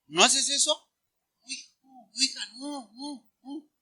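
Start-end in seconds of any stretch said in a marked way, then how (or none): 1.4-3.7 hushed, cooing string of refusals; gently correcting parent. Acted. Parent correcting child, soft pleading. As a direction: softly tut at a small child.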